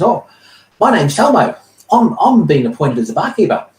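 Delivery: high, bright little halfling voice, eager; squeaky halfling barman introducing himself; high-pitched halfling innkeeper; halfling barkeeper. Acted.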